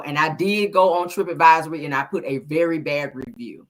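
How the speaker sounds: normal talking voice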